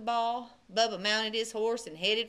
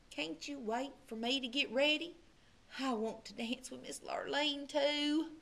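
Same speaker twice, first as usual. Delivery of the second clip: timid plea; 8 dB quieter than usual. A narrator's reading. Timid boy asking to come.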